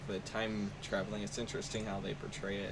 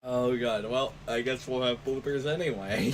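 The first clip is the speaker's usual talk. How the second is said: loud, raised voice breaking into laughter; amused recovery; 3 semitones higher, 7 dB louder than usual. Real, not acted. Host accepts the blooper after choking fit, amused and loud. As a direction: still cracking up, catching breath.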